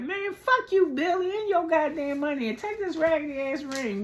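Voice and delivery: high-pitched indignant rant; mock angry kiss-off. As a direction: tell him off in a high, put-on fury. Acted hypothetical kiss-off to Billy.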